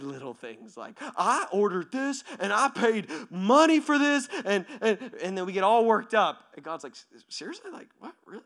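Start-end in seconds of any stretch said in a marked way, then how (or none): 1.0-4.3 high, loud indignant whine; mock tantrum over a wrong order; petty customer outrage. Acted petty customer complaint. 6.9-8.4 high, soft incredulous questions; mock-stunned, under-the-breath reaction; hushed disbelief. Acts God's disbelieving reaction.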